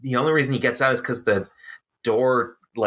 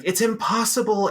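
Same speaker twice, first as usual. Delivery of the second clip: pitched way up, mock dramatic; high meme voice; 11 semitones higher than usual. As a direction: quote it like a cartoon. Quoting meme line.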